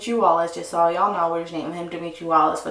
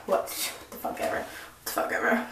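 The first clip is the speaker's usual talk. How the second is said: whispered dismissal; 9 dB quieter than usual. Real, not acted. Muttered aside about ex.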